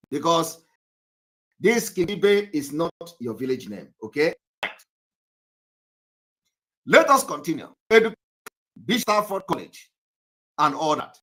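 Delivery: ordinary delivery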